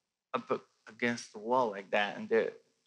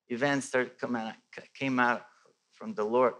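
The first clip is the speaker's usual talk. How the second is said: ordinary delivery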